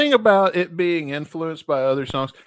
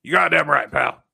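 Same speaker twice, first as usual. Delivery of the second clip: loud gruff boss voice; Vince McMahon impression; 6 dB louder than usual. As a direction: growl it like a TV boss. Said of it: "That's Vince's new job".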